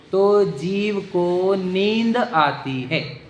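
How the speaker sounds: very slow, drawn-out words; word by word, deliberately slow; slow emphatic teaching. Real, not acted. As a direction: stretch each word out for the listeners. Stressing a key definition slowly.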